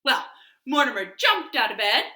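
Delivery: ordinary delivery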